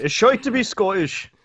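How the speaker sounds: lightly done bad Scottish accent; a hint of a Scottish accent; terrible Scottish accent. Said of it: "My terrible Scottish accent".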